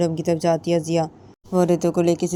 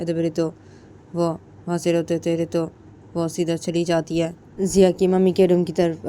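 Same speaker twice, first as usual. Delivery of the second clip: plain reading voice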